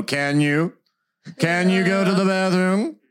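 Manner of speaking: high, slow pointed teacher question; mocking fourth-grade teacher correcting grammar; strict teacher. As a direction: drag out the correction like a picky teacher. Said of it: "she was the teacher that".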